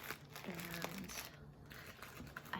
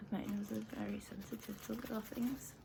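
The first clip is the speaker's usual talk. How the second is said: whispering; 3 semitones higher than usual. Acted. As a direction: whisper it close to the mic. ASMR whisper about tea.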